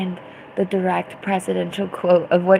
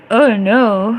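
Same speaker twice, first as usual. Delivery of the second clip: ordinary talk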